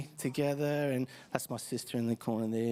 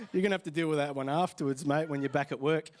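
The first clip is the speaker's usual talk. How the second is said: normal talking voice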